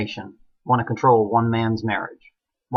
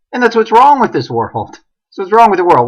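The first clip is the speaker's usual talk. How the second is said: much higher and louder, hammering "wrong"; indignant outcry; 8 semitones higher, 7 dB louder than usual. Real, not acted. Condemning the corrupt world to viewers, an indignant outcry. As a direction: cry it out like a street preacher's climax. Ranting climax about the world.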